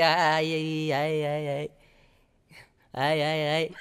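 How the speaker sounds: low, soft, coy sighs; a shy fiancé melting over his bride's voice; bashful sighing. Retold or imitated. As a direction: melt with shy delight on the phone. Bashful lover giggling on phone.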